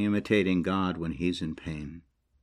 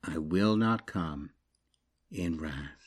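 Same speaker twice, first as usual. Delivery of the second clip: quieter, slow repeat for weight; soft echo; 4 semitones lower, 7 dB quieter, slower than usual. Shown as a demonstration. Preacher repeating God's promise to let it land, soft. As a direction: let the words sink in softly. Echoes the verse softly.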